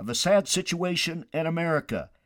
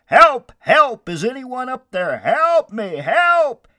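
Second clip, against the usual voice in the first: high, loud cries for rescue; acted panicked yell; yelling for help; 11 semitones higher, 12 dB louder, slower than usual. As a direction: shout desperately up to someone far above. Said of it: "So Jack began yelling for help".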